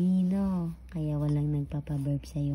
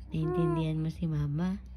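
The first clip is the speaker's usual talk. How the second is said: ordinary talk, nothing marked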